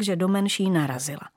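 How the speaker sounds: ordinary delivery, nothing marked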